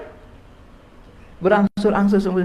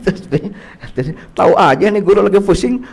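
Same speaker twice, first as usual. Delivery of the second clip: ordinary delivery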